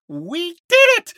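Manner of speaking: high, loud cry of triumph; elated shout. Acted. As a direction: shout it out, thrilled. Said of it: "Pri shouts, elated".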